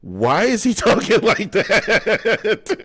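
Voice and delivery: high, drawn-out question breaking into laughter; laughing mock bafflement. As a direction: imitate a baffled onlooker, slowly, cracking up. Imagined onlookers' reaction, laughing.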